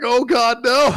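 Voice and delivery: high, loud panicked cry; panicked gasp. Retold or imitated. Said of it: "You were like".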